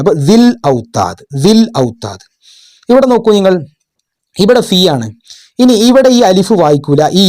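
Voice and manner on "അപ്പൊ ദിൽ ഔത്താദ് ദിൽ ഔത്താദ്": ദിൽ ഔത്താദ് with ദി joined straight to ൽ; ദിൽ ഔത്താദ് with the ya and alif silent; careful Arabic reading. Shown as a demonstration. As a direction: model ദിൽ ഔത്താദ് clearly, a little lower. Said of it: "അലിഫും വായിക്കൂല".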